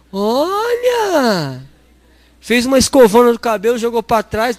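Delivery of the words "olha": amazed exclamation. Real, not acted.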